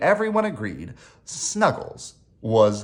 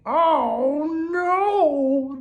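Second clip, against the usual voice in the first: high, voiced hush of dread; fearful stage whisper; 14 semitones higher than usual. A narrator's reading. Friends realise who is knocking, high fearful stage whisper. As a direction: wail it small and high, as if whispering. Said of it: "they all whispered together".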